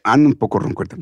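usual speaking voice

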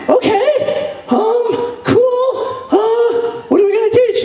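high, nervous stammer; high starstruck fumbling; starstruck nerves. Retold or imitated. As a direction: fluster with your voice way up.

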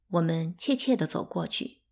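usual speaking voice

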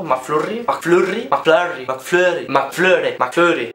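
McFlurry tried many ways, rising then falling; pronunciation attempts. Shown as a demonstration. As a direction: try out McFlurry in different versions. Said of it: "alguien sabe cómo se dice eso?".

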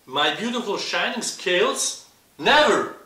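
high, loud outraged cry; indignant refusal. Acted. Rainbow fish refusing to give away his scales, loud and outraged. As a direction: shout NEVER in outrage.

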